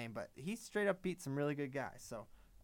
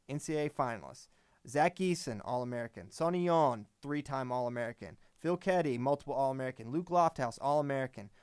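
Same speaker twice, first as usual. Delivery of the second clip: usual speaking voice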